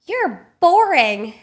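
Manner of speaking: put-on high, loud, slow sneer of a pushy drunk lady; mocking drunk lady. Drunk guest teasing the sober narrator, high slow taunt.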